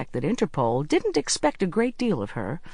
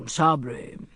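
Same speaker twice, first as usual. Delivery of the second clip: Sabri grunted thickly, disguised. Acted. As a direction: fake a gruff voice through the door. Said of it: "In a thick voice, Robin grunted".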